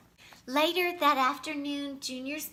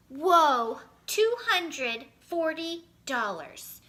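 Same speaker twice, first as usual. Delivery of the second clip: loud, slow amazed counting; excited out loud; 5 semitones higher, 7 dB louder, slower than usual. A narrator's reading. Junior counts the money aloud, amazed. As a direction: burst out with wonder at the sum. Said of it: "Junior was no longer whispering".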